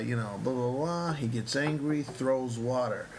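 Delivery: ordinary talk, nothing marked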